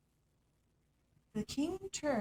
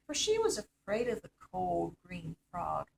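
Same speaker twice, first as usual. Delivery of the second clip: ordinary delivery, nothing marked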